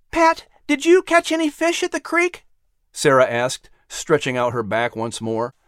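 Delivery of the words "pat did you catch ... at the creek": much higher, altered mother's voice; a cheerful mother checking on her son; mother asking Pat about fishing. Acted.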